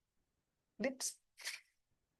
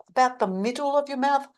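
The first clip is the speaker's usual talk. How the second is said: ordinary talk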